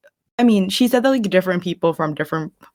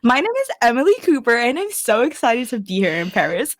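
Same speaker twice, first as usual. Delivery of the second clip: perky mock introduction, high and swooping; over-excited Emily; 5 semitones higher than usual. Parodying Emily introducing herself, high mock excitement. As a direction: gush it like an over-eager show lead. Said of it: "Why did she say that so much?".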